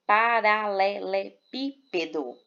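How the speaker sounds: paralelepípedo slowly sounded out, pa-ra-le-le-PÍ-pe-do; stress on PÍ. Shown as a demonstration. Teacher showing the antepenultimate syllable, paralelepípedo slowly broken up with stressed PÍ. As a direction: spell out paralelepípedo by syllables, leaning on PÍ. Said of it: "a sílaba mais forte é o pi".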